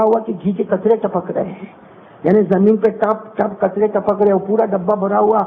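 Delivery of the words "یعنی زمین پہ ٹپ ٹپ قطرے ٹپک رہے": ordinary talk, nothing marked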